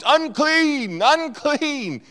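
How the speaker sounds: high, loud warning cry; acted yell. Lepers must warn the marketplace, acted yell. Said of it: "they would have to yell out".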